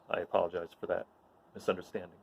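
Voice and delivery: ordinary talk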